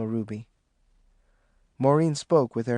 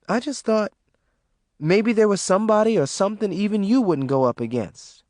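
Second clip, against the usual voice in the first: put-on young girl, higher and hesitant; young daughter, higher and tentative; Maureen voice; 7 semitones higher, 3 dB louder than usual. A narrator's reading. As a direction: ask her mama carefully, higher voice. Maureen explains.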